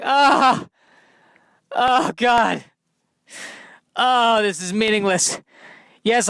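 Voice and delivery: high, loud existential lament; hammed-up high bird despair. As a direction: moan about the pointless flight, high.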